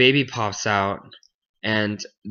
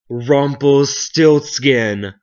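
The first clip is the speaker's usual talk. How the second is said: loud, slow proclamation of a secret name; crowing; 4 semitones higher, 8 dB louder, much slower than usual. Acted. Gnome gloats alone about his secret name, crowing. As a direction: belt it out syllable by syllable, higher.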